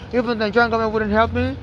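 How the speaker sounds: ordinary talk, nothing marked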